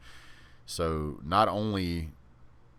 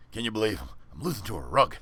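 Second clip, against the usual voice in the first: Rodney Dangerfield; 3 semitones higher, faster than usual. Retold or imitated. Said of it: "Trying to do Rodney Dangerfield".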